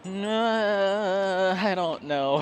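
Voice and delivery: long drawn-out, high, loud "no"; reluctant. Retold or imitated. Housemate asked along to a haunted house, imitated as reluctant. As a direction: imitate a reluctant hemming and hawing.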